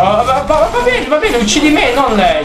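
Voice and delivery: high-pitched, flustered plea; panicked pleading. Real, not acted. Player begging an enemy to spare the girl, panicked.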